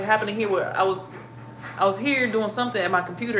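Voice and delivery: ordinary talk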